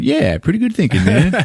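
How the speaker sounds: high sarcastic cheer; mock praise. Watching the orderly's trick, high mock praise. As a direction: toss off high mock approval. Retold own reaction, high pitch.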